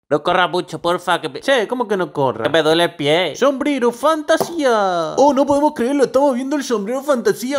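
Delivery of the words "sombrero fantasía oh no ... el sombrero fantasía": high, excited mock play-by-play; over-the-top football commentary; hyped commentator. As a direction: sell the trick like a TV caster.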